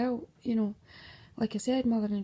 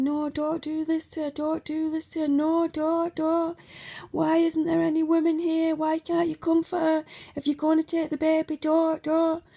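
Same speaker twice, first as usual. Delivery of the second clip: higher, louder, desperate pleading; crying plea; 6 semitones higher, 6 dB louder than usual. Retold or imitated. Partner begging the officers not to take the baby, crying plea. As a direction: beg them through tears, voice rising. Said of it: "He was crying and saying".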